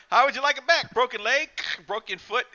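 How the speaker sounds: high, loud mock taunt; taunting doll. Doll taunting the voodoo master, high and loud. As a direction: sneer it out like a vicious doll. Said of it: "He's all like".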